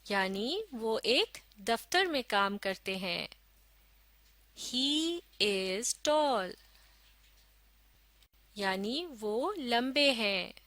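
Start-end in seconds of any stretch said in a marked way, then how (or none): 4.6-6.5 very slow, word by word; slow model reading. Shown as a demonstration. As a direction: read it out slowly for learners to follow. Model reading for learners to follow. (धीरे-धीरे, एक-एक शब्द पढ़कर)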